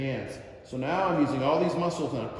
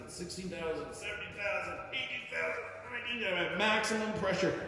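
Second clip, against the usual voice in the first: straining count; 3 semitones higher, 6 dB quieter than usual. Shown as a demonstration. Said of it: "it would look something like this".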